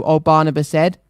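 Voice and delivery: ordinary delivery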